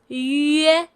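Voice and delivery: yue drawn out, yu gliding into e as in bed; blended slow yue. Shown as a demonstration. Teacher showing learners the combination, slow gliding yue. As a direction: stretch yue from rounded yu to open e. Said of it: "combination of yu plus e".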